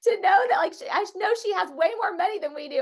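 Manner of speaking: high, disbelieving indignation; retelling her own jealous fury at the bidder, indignant and high; mock outrage. Playful indignant rant. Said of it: "I was so mad".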